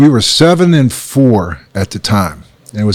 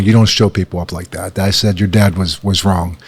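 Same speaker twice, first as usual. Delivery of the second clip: lower, softer, sincere admission; gentle father; 6 semitones lower, 4 dB quieter than usual. Retold or imitated. Father telling his daughter Lauren he was wrong, softly. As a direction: own the mistake quietly to your child.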